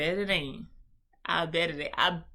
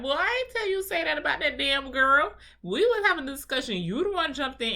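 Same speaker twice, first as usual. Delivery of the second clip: higher and louder angry comeback; heated comeback; 7 semitones higher, 5 dB louder than usual. Retold or imitated. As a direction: fire back, higher and louder. Acted quarrel in the car.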